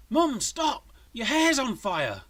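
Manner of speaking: high, loud urgent yell; alarmed shout. A narrator's reading. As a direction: shout a warning to stop her. Son warns mother of fire.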